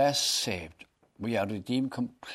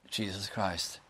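ordinary talk, nothing marked

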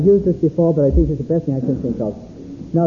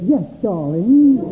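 mock doting husband; 4 semitones higher, 4 dB louder, slower than usual. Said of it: "And he said".

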